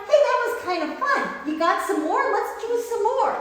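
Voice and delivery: high, eager puppy voice voicing "Hey, that was kind of fun". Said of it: "they're like".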